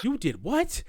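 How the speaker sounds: high, disbelieving "you did what"; hammed-up disbelief; mock shock. Prime's shocked reply retold.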